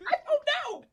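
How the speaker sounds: very high, helpless giggling; laughing hard. Real, not acted. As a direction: giggle the words out. Both cracking up.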